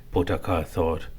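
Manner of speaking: plain reading voice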